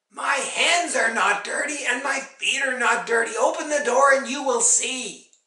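high, loud, insistent retort; cross mother protesting loudly; indignant protest. A narrator's reading. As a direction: argue back and demand the door be opened. Mother argues back through door.

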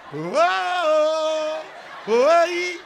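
high, loud whoa to the crowd; excited hype. Real, not acted. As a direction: hype the room up.

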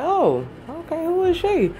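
high, rising, curious "who is she"; high quizzical voice. Retold or imitated. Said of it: "I was like".

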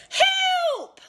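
very high, loud scream; screaming for help. A narrator's reading. Missus Twit flying off, screaming high and loud. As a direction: shriek it as she shoots skyward. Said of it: "she screamed".

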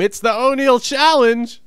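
high, loud game-show announcement; announcer shout. Acted. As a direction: proclaim the segment title with big fanfare. Announces a segment title.

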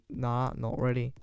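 ordinary talk, nothing marked

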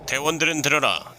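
loud, high military commander; squad orders. Pretend. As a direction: bark orders to the troops.

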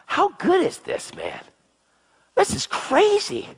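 high, slow marvelling; high amazed exclamation. Real, not acted. Excited preacher marvelling.

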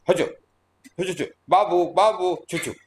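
high, playful chu chu babble; mock Hindi noise. Teacher mimicking Hindi speech as only noise, hammed-up. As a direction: mimic the sounds he hears as noise. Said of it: "I hear only this".

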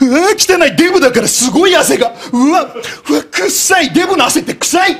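a caricature of a rude middle-aged woman recoiling; mocking old woman. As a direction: squeal it like a disgusted auntie. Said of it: "って押したら".

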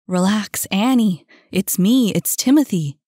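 loud, insistent reassurance; urgent calling out. Acted. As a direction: raise the voice to break through panic. Man calms girl fighting him off.

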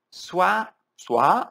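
ordinary talk

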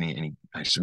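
usual speaking voice

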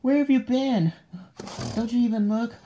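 high, loud, startled demands; alarmed yelling. Acted. Demanding answers about the thing in the house, alarmed yelling. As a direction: yell it out in alarm. Startled, scolding outburst at the prank.

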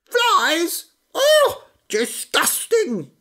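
very high, loud, slow disgust; disgusted chicken. A narrator's reading. Chicken squealing at frog's diet, very high. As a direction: squeal in revulsion as a hen. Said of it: "said the chicken".

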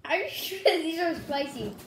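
laughing through the question; giggling disbelief. Real, not acted.

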